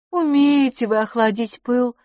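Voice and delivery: high, mildly deflated sigh. A narrator's reading. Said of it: "вздохнула мисс Лафос". (со вздохом, высоким голосом)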